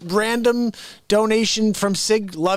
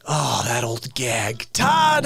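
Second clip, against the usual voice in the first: ordinary talk, nothing marked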